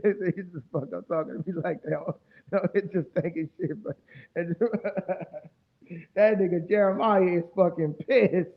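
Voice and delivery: talking through laughter, slowly; can't stop laughing at him. Real, not acted. Laughing at an angry viewer.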